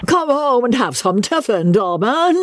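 high, hearty call from behind; a plump friend calls out merrily; jovial cry. Acted. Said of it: "a voice cried behind him".

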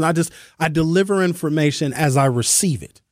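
ordinary talk

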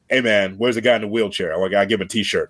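put-on retelling a fan encounter in Nana's accent; Prince Nana accent. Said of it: "the accent".

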